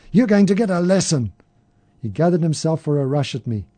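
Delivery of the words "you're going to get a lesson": high loud threat; holler. A narrator's reading. Drunk hollering a threat at the narrator, loud. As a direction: yell the threat. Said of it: "he hollered".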